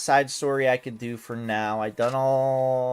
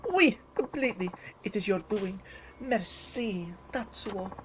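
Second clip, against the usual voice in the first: higher, hammed-up broken-English foreign accent; a foreign diva's shaky fake accent, higher pitched; questionable foreign accent; 4 semitones higher, 4 dB quieter than usual. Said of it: "my questionable accent of the day".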